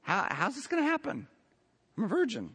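lightly voiced young girl; innocent Mary. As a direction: ask it simply, like a wondering teenager. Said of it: "Mary's just thinking about the basic biology".

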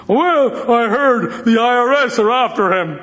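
high-pitched mock gloating; malicious gossip. Gossiping about a disliked man's trouble, sneering relish. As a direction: pass on bad news with relish, voice lifted. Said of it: "Malice is when you laugh and sneer".

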